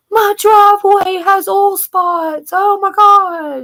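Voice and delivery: high, slow mock wail; panicky sister. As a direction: drag out the fuss like a fretful sister. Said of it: "She's like".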